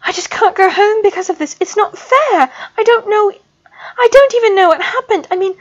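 words tumbling out in panic; panicked babbling. A narrator's reading. Student babbles in panic to the professor, high and fast. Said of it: "babbled as coherently as she could".

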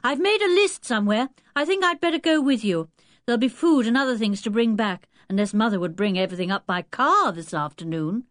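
put-on high, brisk-minded boy; busy Julian. Julian plans the trip with Dick, lively and busy.

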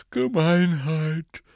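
slow, high, stretched yawn on the word. A narrator's reading. Ron sympathises with his friend, yawning. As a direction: yawn right through the word. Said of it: "gähnte Ron".

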